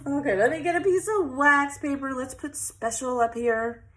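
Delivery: usual speaking voice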